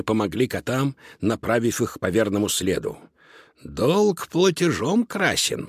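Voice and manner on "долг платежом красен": slow, higher-pitched warning; crocodile's warning. A narrator's reading. Crocodile boss reminds cats of their debt, warning. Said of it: "предупредил их тогда крокодил".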